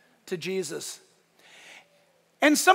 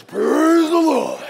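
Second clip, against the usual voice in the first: loud, slow, booming praise; drawn-out hammed-up rock roar; big boulder voice; 6 semitones higher, 9 dB louder, much slower than usual. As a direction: play a huge boulder bellowing. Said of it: "Big rocks and boulders".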